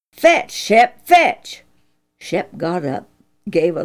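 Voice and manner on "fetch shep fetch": "Fetch" pushed higher and louder, an impatient repeat; father losing patience with the dog; impatient command. Acted. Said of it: "What's the matter with this dog tonight?".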